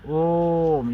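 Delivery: ओम held long and loud, like a chant; long drawn Om. Shown as a demonstration. Teacher showing students the long-vowel mark, drawn-out loud ओम. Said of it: "दीर्घ उच्चारण चिन्ह मतलब हे".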